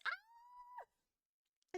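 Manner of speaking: very high squeal of delight; excited squeal. Real, not acted. Streamer gets a first emote, delighted squeal. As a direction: squeal like a fan seeing a gift.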